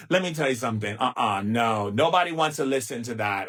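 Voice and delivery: usual speaking voice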